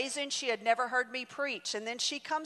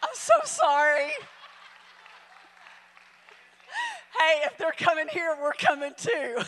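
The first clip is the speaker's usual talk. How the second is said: laughing joke; 5 semitones higher, 5 dB louder than usual. Real, not acted. Joking apology to the congregation.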